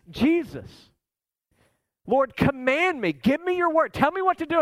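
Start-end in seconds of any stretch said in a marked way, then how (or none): 2.1-4.6 higher, pleading cry to the Lord; a desperate call from someone sinking; urgent plea. Acted. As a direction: beg for the word with rising urgency. Peter begs Jesus from the water.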